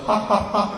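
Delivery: a buddy's canned laugh, played small; imitated laugh. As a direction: put on three evenly spaced ha's. John's laugh in the story.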